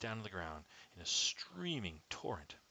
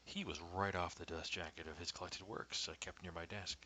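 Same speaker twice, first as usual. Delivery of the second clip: ordinary delivery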